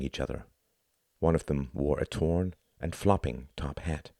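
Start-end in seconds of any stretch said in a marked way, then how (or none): none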